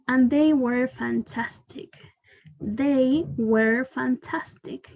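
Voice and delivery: slow model reading. Shown as a demonstration. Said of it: "teacher models the sentence slowly".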